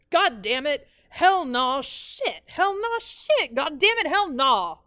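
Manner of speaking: loud, high cursing in an Alabama drawl; drunk stepfather cussing. Retold or imitated. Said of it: "which is the only time he ever talks fast".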